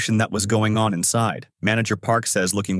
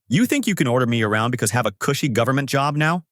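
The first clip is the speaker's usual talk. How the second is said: ordinary delivery, nothing marked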